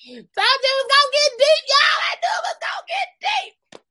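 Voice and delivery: high, loud gleeful cry; excited shout. Real, not acted. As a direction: crow it out, delighted.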